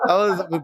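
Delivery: loud high burst of laughter; delighted high laugh. Real, not acted. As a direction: crack up with delight. Laughing at Bahnsen's answer.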